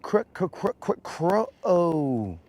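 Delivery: playful caw of a rooster; rooster imitation. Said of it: "so they could be all like".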